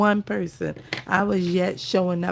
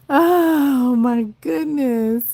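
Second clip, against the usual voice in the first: drawn-out, louder, higher oh my goodness; overwhelmed cry of joy; 5 semitones higher, 6 dB louder, slower than usual. Real, not acted. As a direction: let the joy burst out slowly. Overwhelmed seeing longtime supporter.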